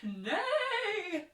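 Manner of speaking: high, loud horsey neigh; whinny it out like a horse; playful horse whinny. Acted. Horse sound in picture book.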